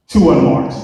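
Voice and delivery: ordinary delivery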